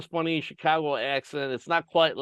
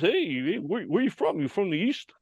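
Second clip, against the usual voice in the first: imitated New Jersey accent, higher; higher-pitched put-on New York accent; heavy East Coast accent; 5 semitones higher than usual. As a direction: mimic a curious East Coast guy. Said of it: "heavy East Coast accent".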